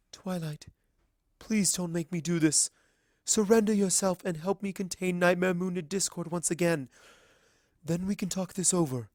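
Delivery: a hint of a sad queen begging; pleading princess. Celestia pleads with Twilight.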